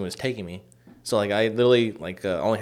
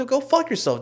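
high-pitched mock fury; retold angry retort. Cursing at brother in retold quarrel, mock fury. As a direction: act out telling him off.

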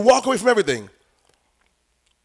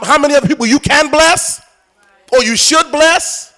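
raised, driving preacher's questions; forceful preaching; 6 semitones higher, 7 dB louder than usual. Real, not acted. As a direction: press the congregation with louder, higher challenges.

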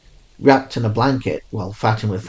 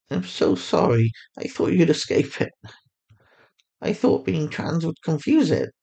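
higher mother voice, full of regret; remorseful mother; 7 semitones higher than usual. A narrator's reading. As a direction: apologize again and again, hurting. Narrator voices Fatima, remorseful.

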